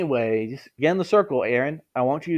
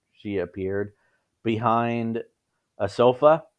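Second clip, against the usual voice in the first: plain reading voice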